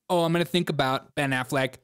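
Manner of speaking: normal talking voice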